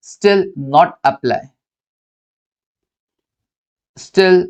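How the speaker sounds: slow, spaced-out dictation pace; unhurried note-taking pace. Shown as a demonstration. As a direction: read each word out slowly so students can write it down. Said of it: "dictating notes slowly".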